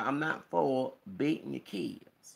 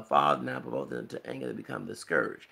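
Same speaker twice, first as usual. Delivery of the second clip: much lower, solemn preacher voice; scripture quote; 13 semitones lower than usual. Retold or imitated. Quoting Galatians to viewers, much lower preacher voice. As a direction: intone the verse like a deep-voiced preacher.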